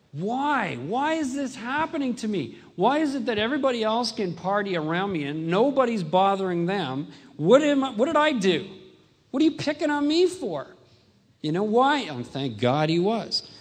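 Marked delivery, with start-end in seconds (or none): 0.1-12.0 high, loud desperate pleading; anguished cry. Retold or imitated. A desperate young man crying out to God, anguished. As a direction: cry out why at the heavens. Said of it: "And I began to go".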